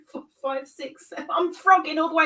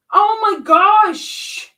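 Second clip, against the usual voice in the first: exasperated wail; 7 semitones higher, 9 dB louder, much slower than usual. Real, not acted. Exasperated at more mistakes.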